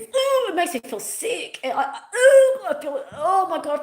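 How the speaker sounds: half laugh, half groan at feeling sick; giggling through pretend nausea; queasy laughing.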